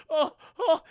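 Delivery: high, loud alarmed ohs; mock alarm. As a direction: yelp in comic alarm. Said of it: "just like".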